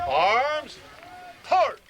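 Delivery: loud barked order, the last word leaping high; drill command. Shown as a demonstration. Instructor ordering the fighting position, loud barked command. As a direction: bark it out across the field.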